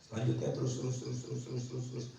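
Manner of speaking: very soft, low mumble; skimming aside. Real, not acted. Preacher skipping ahead in the passage, skimming aside. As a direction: skim along under your breath, low and quiet.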